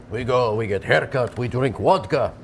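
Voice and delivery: hammed-up Russian accent; emphatic mock Russian boast. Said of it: "You could do a Russian accent".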